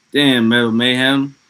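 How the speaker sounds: loud, pleased call to a chatter; excited shout-out. Real, not acted. Streamer greeting Metal Mayhem in chat, loud and excited. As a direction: hype up Metal Mayhem's comment.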